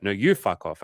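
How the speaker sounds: brisk mock comeback; quick, punchy retold retort. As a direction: fire it straight back, imitating her. Retold back-and-forth, her turn.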